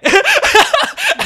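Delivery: loud high-pitched laugh; high burst of laughter. Real, not acted. Friend laughing hard while recapping the film, high loud laugh. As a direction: break into a big squealing laugh.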